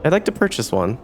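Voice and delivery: usual speaking voice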